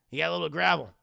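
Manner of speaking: usual speaking voice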